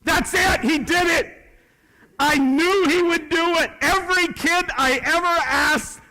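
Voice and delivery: loud, high triumphant shout; excited outburst. Real, not acted. Speaker to audience as the kid says um, excited outburst. Celebrates the student's expected "um.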